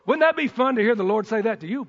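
normal talking voice

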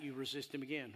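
ordinary delivery

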